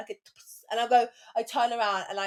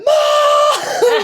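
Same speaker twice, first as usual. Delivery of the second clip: long high screamed call; yelling for mum; 14 dB louder than usual. Retold or imitated. Daughter calling mum about the dog, outraged yell. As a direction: shriek for backup, hammed up.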